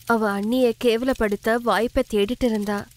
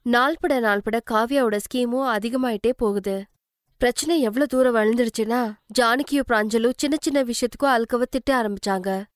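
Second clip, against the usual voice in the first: usual speaking voice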